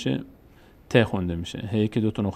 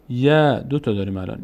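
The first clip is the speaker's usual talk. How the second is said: ordinary delivery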